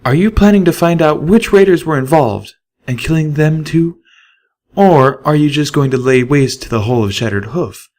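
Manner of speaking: a mare grasping for reason; a touch of desperation. A narrator's reading. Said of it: "With a touch of desperation".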